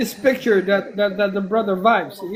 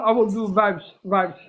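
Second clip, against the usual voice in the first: usual speaking voice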